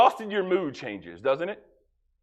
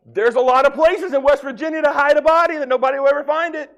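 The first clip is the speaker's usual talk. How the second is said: louder and higher, near shouting; loud joking; 10 semitones higher, 5 dB louder than usual. Real, not acted. Preacher joking loudly to the congregation about spouses. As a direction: hammer the joke home to the crowd.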